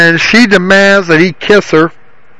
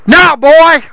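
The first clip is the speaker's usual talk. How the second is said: thundering; 8 semitones higher than usual. A narrator's reading. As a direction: boom the order at the boy. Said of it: "thundered Don".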